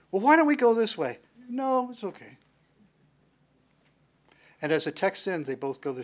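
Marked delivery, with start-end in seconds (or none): 0.1-1.2 coaxing suggestion. Acted. Esau coaxes Jacob along. 1.5-2.4 much higher, breezy brush-off; polite refusal. Acted. Jacob brushing off his brother's offer, high polite decline.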